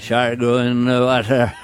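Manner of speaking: put-on slow Quint accent from Jaws; gruff salty sea captain drawl; Robert Shaw impression. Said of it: "Robert Shaw in Jaws".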